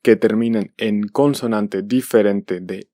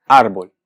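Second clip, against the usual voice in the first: árbol said loudly as ÁR-bol; árbol stressed on the next-to-last syllable; stress on first syllable; 2 semitones lower, 5 dB louder than usual. Shown as a demonstration. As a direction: hit ÁR in "árbol", let bol drop. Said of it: "Como árbol es grave".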